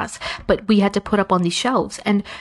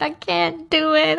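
much higher and slow, like about to cry; mock whimper; 8 semitones higher, slower than usual. Student telling the math teacher she can't do it, teary and high. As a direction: whine it out, close to tears.

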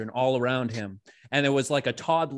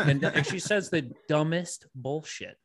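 normal talking voice

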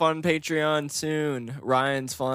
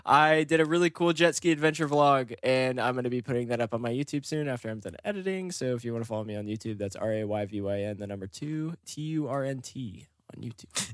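rapid commercial spiel. Real, not acted. Host plugging a YouTube channel to listeners, rapid commercial patter. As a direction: rattle it off like an ad disclaimer. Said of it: "You sound like one of those commercial people for a second".

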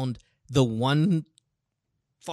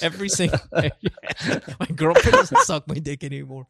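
laughing; 3 semitones higher, 4 dB louder than usual. Retold or imitated. Mocking cheating boyfriend's plea.

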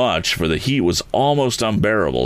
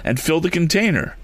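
much higher, pressing voice; urgent; 12 semitones higher than usual. A narrator's reading. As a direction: push the plan urgently. Tom urging in danger.

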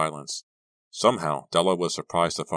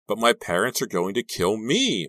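loud, high-pitched anxious protest; whining, worried objection; insistent; 6 semitones higher, 6 dB louder than usual. A narrator's reading. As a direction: insist in mounting distress. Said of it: "Maya insisted".

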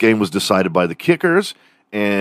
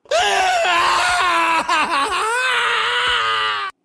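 long, very high wail; mock shriek. Ranting about awful Boston accents, long mock shriek. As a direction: let out a drawn-out, high mock scream. Outburst after hating the accent.

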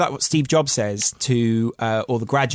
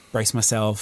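ordinary talk, nothing marked

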